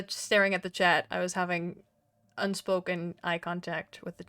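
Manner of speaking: ordinary delivery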